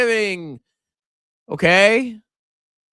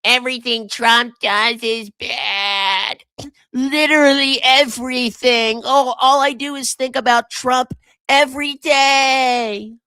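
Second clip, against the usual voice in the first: high, whiny, slow leftist parody; mocking a leftist; 8 semitones higher, slower than usual. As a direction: sneer it like an obsessed leftist. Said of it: "I'd have to be, like, a famous leftist".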